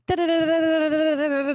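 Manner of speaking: high rattled-off da-da-da; rapid brain-noise babble. Acted. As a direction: imitate a scrambled brain on one high note. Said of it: "My brain is like".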